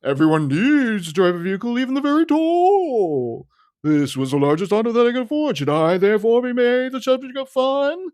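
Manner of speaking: high, stiff, pompous put-on voice; indignant tall man. Tall driver scolding Nelson in a high pompous voice. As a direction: lecture the boy with wounded dignity. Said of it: "It's like".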